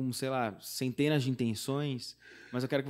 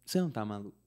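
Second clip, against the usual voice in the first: tender reply; 4 semitones lower, 7 dB quieter, faster than usual. Retold or imitated. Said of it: "ela virou pra mim e falou".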